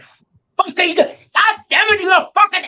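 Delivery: high, loud screaming rage at the boss; angry rookie yelling at his sergeant; furious yell. Retold or imitated. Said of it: "I started yelling at him".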